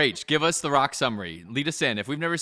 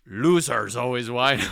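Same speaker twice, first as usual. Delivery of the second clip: Gerard Butler quote; 6 dB louder, slower than usual. Retold or imitated. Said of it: "sounding like Gerard Butler".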